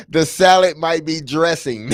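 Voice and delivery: laughing through the punchline. Real, not acted. Cohost laughing at the refrigerator joke. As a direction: crack up while delivering the punchline.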